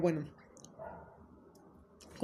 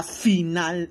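louder hyped final; hyped shout; 8 dB louder than usual. Shown as a demonstration. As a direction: belt out the word final. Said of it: "ultra mega". (final gritado con emoción)

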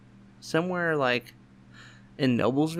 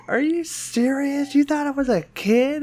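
mocking sister; 14 semitones higher, 3 dB louder than usual.

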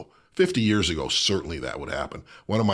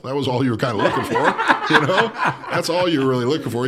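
laughing, a bit faster; 7 semitones higher, 7 dB louder, faster than usual. Real, not acted. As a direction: say it through a laugh. Laughing at old winemaking.

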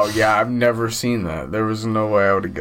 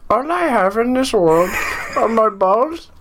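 put-on Middle Eastern accent with lisp; 13 semitones higher than usual. Reading a movie quote for friends in a Middle Eastern accent with a lisp. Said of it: "what accent should [name] go for".

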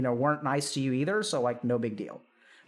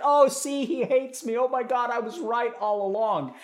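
vindicated parent; 6 semitones higher than usual. Acted. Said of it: "they're like".

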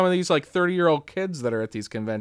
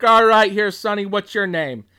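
loud, high, brisk friendly patter; imitated jovial autograph signer; Tom Atkins impression; 6 semitones higher, 6 dB louder than usual. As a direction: address a young fan heartily. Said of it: "inflection is so spot on for him".

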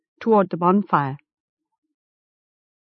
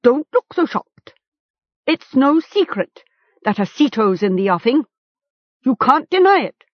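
higher-pitched, pressing chieftain; accusing captor; 6 semitones higher, 4 dB louder than usual. A narrator's reading. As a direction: accuse the captive to his face.

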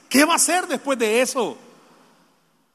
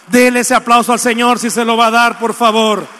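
loud rallying call; 8 dB louder than usual. Real, not acted. Preacher calls for applause.

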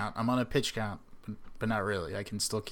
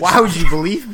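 much louder, higher disbelief; loud incredulous; 8 semitones higher, 16 dB louder than usual. Real, not acted. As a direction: blurt it out in disbelief. Laughing incredulous outburst.

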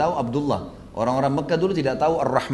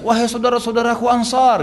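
raised, high-pitched call to the Ansar; a retold shout for help, higher and louder; 8 semitones higher, 4 dB louder than usual. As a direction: call them over from a distance, voice lifted high. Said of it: "Bilal teriak".

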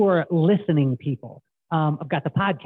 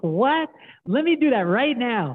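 eager disbelief; 7 semitones higher than usual. Retold or imitated. Excited jump at a cheap deposit.

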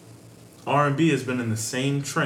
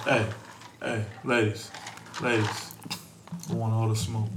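cool voice; 5 dB quieter than usual. Acted. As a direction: play it cool for the ladies. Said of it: "Why you putting on that voice".

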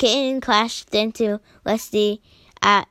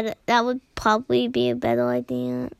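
ordinary delivery, nothing marked

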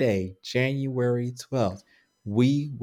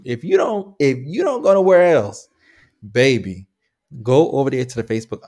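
emphatic pleading; 3 semitones higher, 7 dB louder than usual. Real, not acted. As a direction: coax them warmly, full voice. Pleading with listeners.

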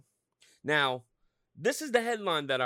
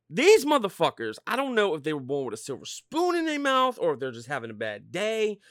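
ordinary talk

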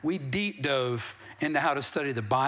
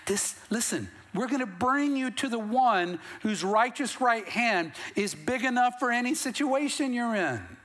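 raised, higher-pitched sermon delivery; impassioned preaching; 7 semitones higher than usual. Acted. Church telling newcomers whose hand is big enough, impassioned preaching. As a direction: preach it with mounting conviction.

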